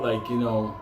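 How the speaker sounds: ordinary talk, nothing marked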